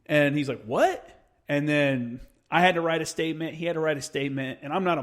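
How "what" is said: high, sharply rising question; surprised query. Retold or imitated. Gunny hearing the kid's accusation, surprised. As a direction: act out disbelief, pitch leaping up.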